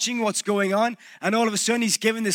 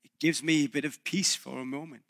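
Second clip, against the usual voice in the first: soft, low, giving in; a quiet brush-off, lightly acted; resigned Pilate; 5 semitones lower, 8 dB quieter than usual. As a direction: give in quietly, just wanting some peace. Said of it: "He's like".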